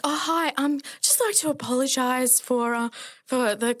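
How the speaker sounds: high, polite phone voice, lightly put on; a touch of formal telephone manners; professional voice. Nurse calling back the High Commission, lightly polite phone voice. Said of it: "put on my most, like, professional".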